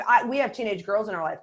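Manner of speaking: ordinary delivery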